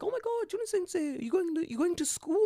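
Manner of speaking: very high-pitched, cooing greeting; imitating a fussing old lady in falsetto; gushing old lady. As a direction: squeal it like a delighted granny. Voicing old ladies greeting him.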